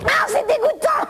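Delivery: high yelled disgust; disgusted shriek. Acted. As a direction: scream in revulsion. Shocked disgust at Jean.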